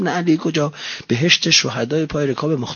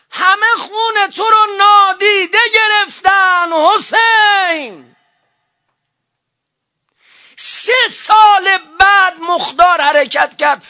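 high, loud wailing preacher's cry; preaching at full force; 8 dB louder, slower than usual. Real, not acted. Preacher grieving to the congregation, shouting high. Mourning sermon climax.

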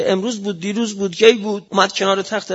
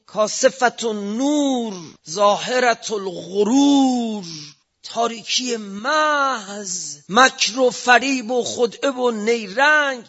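slow, drawn-out sermon cadence; slow preaching lament; 3 semitones higher, much slower than usual. Acted. Preacher mourning the dark society, slow drawn lament. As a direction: stretch each phrase like a lament.

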